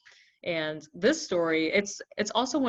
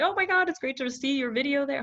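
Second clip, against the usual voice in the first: excited friend; 9 semitones higher, 6 dB louder than usual. Retold or imitated. Friend quoted, excited about video.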